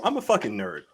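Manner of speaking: ordinary talk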